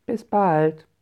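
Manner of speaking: high, loud goodbye call; calling out farewell. A narrator's reading. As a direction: call it after someone leaving. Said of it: "riefen sie".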